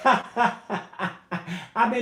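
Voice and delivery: slow, laughing through the words. Real, not acted. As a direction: chuckle at yourself while admitting it. Laughs at own admission.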